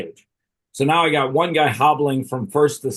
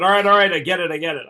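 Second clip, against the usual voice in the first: higher, louder start, then easing off; mock fed-up giving in; retold exasperated surrender; 5 semitones higher, 4 dB louder, faster than usual. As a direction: concede defeat, as if waving off a telling-off. Giving in after hitting six batters.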